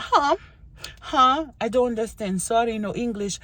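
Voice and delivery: high-pitched baffled newcomer; acted confusion. Newcomer not understanding English, acted bafflement. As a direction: pitch the puzzled question high, then apologize. Acting her confused younger self.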